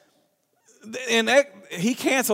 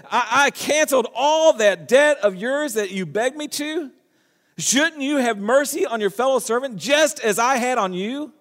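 higher, pressing reproach; indignant king; 6 semitones higher than usual. Acted. King confronting the unmerciful servant, indignant. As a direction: demand why he showed no mercy. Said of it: "What does he say?".

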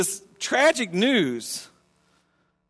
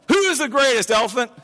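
higher, pushy lion demanding an answer; swaggering lion; 7 semitones higher, 3 dB louder than usual. Acted. Lion to the zebra, demanding to hear he's greatest. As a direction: puff up like a proud lion.

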